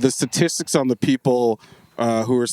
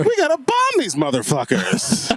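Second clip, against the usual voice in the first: higher, slow, hammed-up Seinfeld voice; Jerry Seinfeld impression; 10 semitones higher than usual. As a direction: deliver a war threat as a sitcom comic. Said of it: "I can't do, I don't do Jerry Seinfeld".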